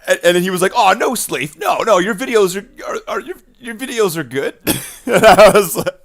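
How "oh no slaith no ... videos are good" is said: high, louder mock backpedalling; imitated flustered reassurance. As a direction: stumble into hasty reassurance, imitating him. Retold streamer hastily reassuring him.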